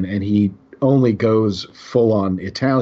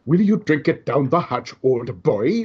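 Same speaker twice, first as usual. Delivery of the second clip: put-on British-by-Italian accent; 3 semitones higher than usual. Host mimicking an actor's confused accent, mock British via Italian. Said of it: "a British accent done by an Italian guy".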